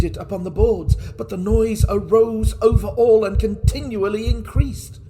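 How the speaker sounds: from tense telling to a high, loud, frantic cry; mounting frenzy. Acted. Murderer hearing the heart before the police, building from urgent to frantic high shouting. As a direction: let the panic climb until he is nearly screaming.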